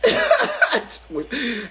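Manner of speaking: high burst of laughter; laughing. Real, not acted. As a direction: crack up at the joke. Laughing at his own rant.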